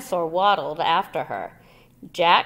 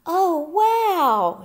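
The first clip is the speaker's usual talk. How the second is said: amazed gasp; 11 semitones higher, 6 dB louder than usual. A narrator's reading. Annie amazed at the sight.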